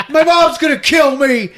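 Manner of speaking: very high, loud whine; hammed-up distraught villain; mock anguished wail. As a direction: yell it like a panicking kid. Villain wails about his mother.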